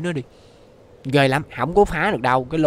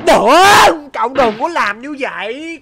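pleading; 11 semitones higher, 10 dB louder, much slower than usual. Acted. Begging a character to stop.

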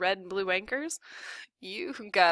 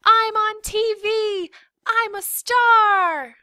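high, drawn-out gleeful yell; very high, loud triumphant shout; shouting to the world; 11 dB louder, much slower than usual. A narrator's reading. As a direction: holler it out like an overjoyed kid. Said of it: "shouted to the world".

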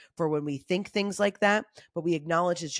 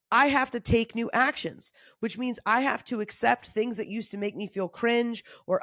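ordinary talk

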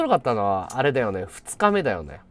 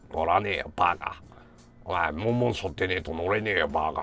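low, gruff brush-off; gruff low grumble; 10 semitones lower, 7 dB quieter than usual. Retold or imitated. Grumpy senior refusing the shrine ride, low gruff grumble. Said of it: "って言いながら".

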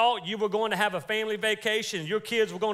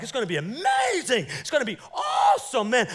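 higher, shouted mock hype; mock excited shout; 4 semitones higher than usual. Believer imagining fasting, mock excited shout. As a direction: ham up the big expectation. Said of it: "You're like".

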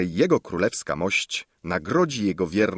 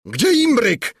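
high, loud urgent shout; king's shout; 7 dB louder, slower than usual. A narrator's reading. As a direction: shout for the kettle in alarm. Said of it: "krzyknął król". (głośny, wysoki krzyk króla)

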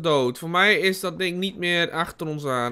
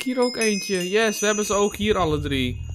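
ordinary talk, nothing marked

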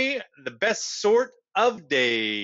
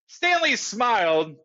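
ordinary delivery, nothing marked

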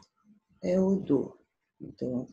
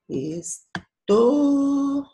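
"Esto-" with the final "to" long, high and loud; ударный слог; 10 semitones higher, 7 dB louder than usual. Shown as a demonstration. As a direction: punch and stretch "to" in "Esto-". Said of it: "a sílaba forte aqui".

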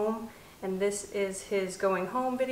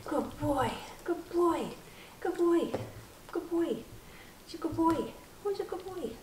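high, sing-song puppy praise; squeaky praising a dog; 8 semitones higher, 5 dB quieter than usual. Real, not acted.

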